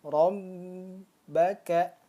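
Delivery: Rabbaka with the b held and hummed like rombaka; wrong held b. Shown as a demonstration. Teacher demonstrating the wrong held ba. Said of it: "contoh yang salah seperti ini".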